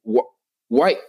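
ordinary talk

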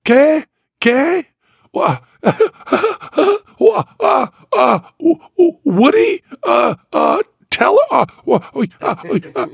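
high, stammering giggly babble; giddy confused Jandro. Retold or imitated. Penn imitates the magician Jandro, baffled and giggling, high stammer. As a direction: babble like a flustered twelve-year-old giggling. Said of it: "he's out of his mind".

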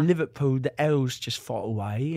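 imitated Liverpool accent, dropped L's. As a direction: mimic a dialect coach in Liverpool accent. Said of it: "the dialect coach goes through the U K".

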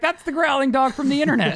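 mock fan. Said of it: "everyone would be like".

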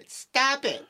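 put-on high, shrill old lady shriek in court; crazy old lady voice. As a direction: snap it like a cranky old woman. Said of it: "with a crazy old lady voice".

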